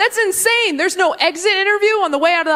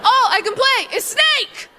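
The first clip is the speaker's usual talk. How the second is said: put-on whiny kid wail; 12 semitones higher than usual. Kids' wailing complaint.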